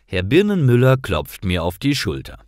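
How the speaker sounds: ordinary delivery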